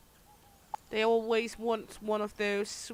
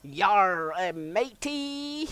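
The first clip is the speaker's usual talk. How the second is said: pirate voice; 5 dB louder, much slower than usual. Pretend. Pirate talk to chat.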